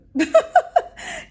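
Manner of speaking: a burst of high, loud laughter. Real, not acted. Warning viewers off connecting flights, a loud high laugh. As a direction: laugh at her own advice.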